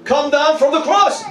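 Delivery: high-pitched, jeering challenge; insulting taunt. Acted. Thief on the left insults Jesus on the cross, jeering. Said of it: "The left one insults Jesus by saying".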